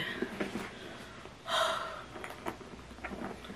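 long, soft, airy sigh; real sigh of wonder; overwhelmed sigh. Real, not acted. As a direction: let out a long breath in awe. Overwhelmed looking at the bag.

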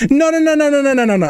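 rapid high protesting no's; frantic denial. Real, not acted. Streamer frantically denying chat's reading, high pitched. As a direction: cut them off in alarm.

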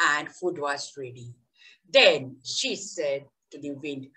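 lively story narration for a class; storytelling to children. Acted. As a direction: tell it like a fairy tale to kids.

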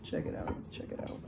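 hushed, breathy-free whisper to the camera. Real, not acted. Filming quietly while family is nearby, whispering. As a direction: keep it down, just whispered.

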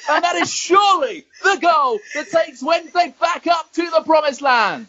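high ecstatic commentator yell, slowing at end; goal celebration shout. Pretend. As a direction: scream the winner like live TV. Commentating an imagined winning goal.

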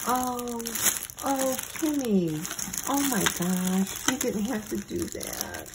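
high, touched "oh" on opening a gift; real, overwhelmed gratitude; excited and touched. Real, not acted. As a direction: gush at a friend's surprise present. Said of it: "I'm getting all excited".

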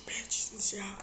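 strained whisper through burning mouth; hushed gasp from spicy heat. Real, not acted. Mouth burning from hot sauce.

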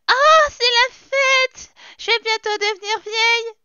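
high, loud, stretched-out squeak; squeaky character voice. Pretend. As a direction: squeal it like a cartoon. Voices Sim, high put-on voice. (voix de personnage aiguë et forte)

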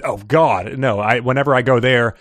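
ordinary talk

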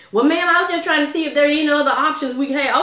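a hint of a smooth phone supervisor; imitating the supervisor. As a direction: lightly put on his polite helpful tone. Supervisor's line in retold call.

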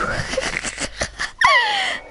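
high laugh. Real, not acted. Friend mocks him in game.

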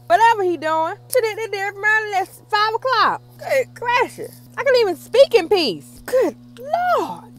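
loud, high-pitched griping over noise; real irritated holler, voice pushed up; exasperated shouting. Real, not acted. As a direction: shout the complaint over a racket. Complaining over weed eater noise while vlogging.

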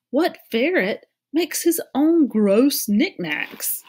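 high, loud, slow disbelief; incredulous challenge. A narrator's reading. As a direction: stretch out the question in loud disbelief. Argues squirrel is not normal.

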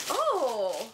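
ordinary delivery

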